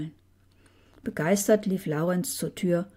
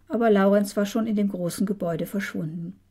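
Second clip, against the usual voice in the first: ordinary delivery, nothing marked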